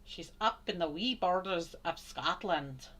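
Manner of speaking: playful Scottish lilt drifting towards Irish; attempted Scottish accent. Painter trying out Scottish for viewers, wobbly Scottish-Irish accent. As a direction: have a go at Scottish, not quite convincingly. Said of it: "I don't know if that was more Irish than Scottish".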